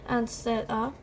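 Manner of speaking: ordinary delivery, nothing marked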